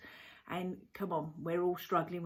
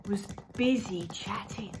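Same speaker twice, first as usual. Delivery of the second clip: whisper. Shown as a demonstration. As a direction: breathe the words without voice. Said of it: "repeated as a whispered echo".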